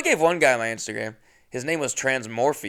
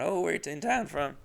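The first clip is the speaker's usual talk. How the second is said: high, soft polite question; mock small-talk lilt; imitating own small-talk voice; 5 semitones higher, 5 dB quieter than usual. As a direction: ask lightly, pitched up and quiet. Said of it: "That's what my voice sounded like".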